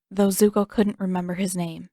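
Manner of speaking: usual speaking voice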